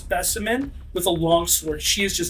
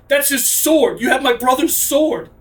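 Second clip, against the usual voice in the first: rising anger, louder, higher; angry accusation; 5 semitones higher, 5 dB louder than usual. Acted. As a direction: accuse her, shaken and furious. GM voices Simona confronting Boudica.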